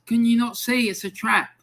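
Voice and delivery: high-pitched, insistent retold plea; exasperated warning. Warning mates about a trap, exasperated. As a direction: plead with mates to see sense.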